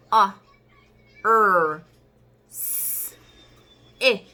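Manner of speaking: "A, R, S, E" as separate sounds, S a long hiss; sounded-out letters. Shown as a demonstration. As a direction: sound out "A, R, S, E" with pauses. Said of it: "echamos el aire". ("A, R, S, E" sonido por sonido, con pausas)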